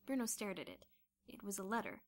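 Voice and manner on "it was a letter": plain reading voice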